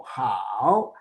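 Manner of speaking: 好 dragged low, then turned up; question tone. Shown as a demonstration. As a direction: bend 好 into a long question. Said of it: "they are using question tone".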